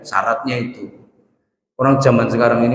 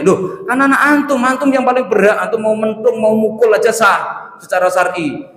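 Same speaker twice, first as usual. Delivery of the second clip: higher, raised astonished reply; retold surprise; 7 semitones higher than usual. Preacher baffled that a father needs help with his own son. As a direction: act baffled at the request.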